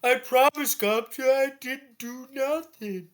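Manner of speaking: high, slow, whiny plea; pleading goblin. Retold or imitated. Goblin pleading with Gob, high whiny plea. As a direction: beg him to believe you. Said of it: "He goes".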